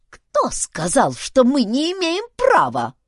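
screechy, scornful question; creaky old man. A narrator's reading. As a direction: creak out a retort as an old chief. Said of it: "проскрипел старик".

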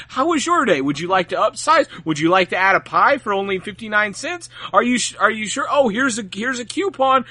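chirpy high cashier pitching extras; pushy, cheery drive-thru upsell voice. Retold or imitated. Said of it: "I don't wanna be".

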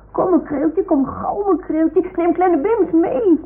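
high, singsong call to come along. Acted. Toy dog repeats the callers' summons to its master, calling out high. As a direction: call it out high, drawing out the end. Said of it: "Ze roepen".

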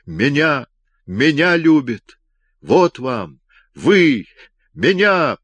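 loud, high, incoherent cries of joy; triumphant yelling. A narrator's reading. Robert alone in the lift, yelling that she loves him, triumphant. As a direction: shout it out, giddy with triumph. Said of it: "торжествующий голос бессвязно вопил".

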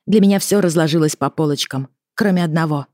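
usual speaking voice

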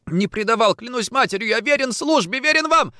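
high, rising oath of loyalty; desperate pleading. Acted. Suspect pleads at gunpoint.

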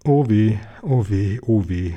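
lightly acted wail, sinking in pitch; mock sobbing. A narrator's reading. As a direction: lament with a touch of a sob. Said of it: "schluchzte Frau Hai".

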